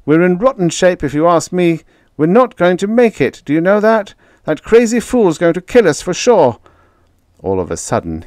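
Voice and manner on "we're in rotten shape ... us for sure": high, loud frightened outburst; panicky. A narrator's reading. Terrified kid ranting about the reckless leader. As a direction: voice climbing in panic.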